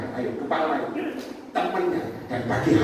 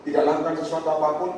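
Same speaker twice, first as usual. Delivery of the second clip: ordinary talk, nothing marked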